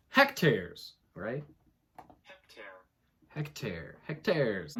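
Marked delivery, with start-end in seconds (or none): none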